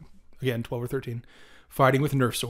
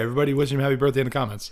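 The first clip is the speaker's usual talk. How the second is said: normal talking voice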